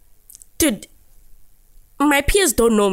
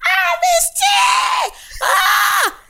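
fans shouting; much slower than usual. Retold or imitated. Crowd screaming as she takes the decks. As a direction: scream her name like a crowd. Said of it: "as soon as I'm on the decks".

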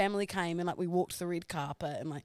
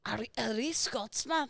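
Scottish accent; 3 semitones higher, 3 dB quieter than usual. Shown as a demonstration. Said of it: "you're going back to German a bit".

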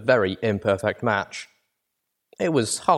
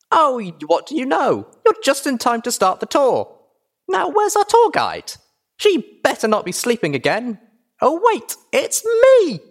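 put-on very high, loud, giddy child voice; squeaky, bubbly filly; excitable filly tour guide; 6 dB louder than usual. A narrator's reading. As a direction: play a hyper little girl hosting a tour.

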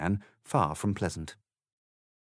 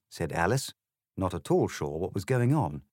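plain reading voice

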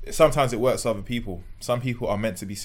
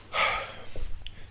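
long voiceless exhale, imitating mum; speechless mum's breath; 4 dB quieter than usual. Mum too upset to speak, exasperated sigh. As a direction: let out a wordless, exasperated breath. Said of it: "when your mum can't speak, she's like".

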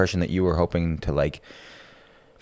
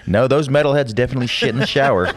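laughing; 6 semitones higher, 3 dB louder, faster than usual. Real, not acted. Joking reply, laughing.

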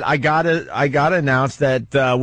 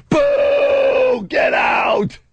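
sudden high yell to scare; shouting; much slower than usual. Pretend. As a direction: jump out and shout. Host scaring guest at Thanksgiving.

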